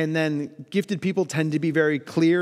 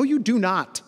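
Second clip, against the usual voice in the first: ordinary talk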